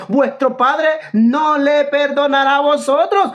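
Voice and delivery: versículo declamado, lento y más agudo; cita enfática. Retold or imitated. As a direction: recalca cada palabra, subiendo el tono. Recita el versículo contra su rival. (cita bíblica lenta y enfática)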